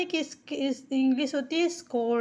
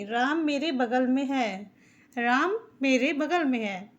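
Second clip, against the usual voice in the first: normal talking voice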